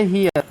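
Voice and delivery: "हीयर" with ee gliding into a; ee-a ending. Shown as a demonstration. Teacher gives learners the ERE example, "हीयर" with ee-a glide. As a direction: say "हीयर" as hee-ar, falling at the end. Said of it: "उसका उच्चारण ई आ होता है".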